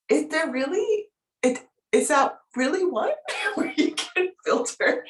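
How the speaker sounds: high-pitched incredulous question, laughing midway; amused disbelief. Retold or imitated. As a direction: quote a friend's astonished question, breaking into a laugh. Quotes amused friend's incredulous question.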